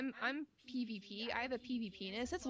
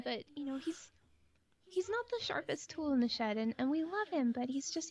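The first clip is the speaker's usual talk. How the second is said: put-on sweet girly voice; 3 semitones higher than usual. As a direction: play the sweet apologetic girl to calm him down. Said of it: "So I start talking like this".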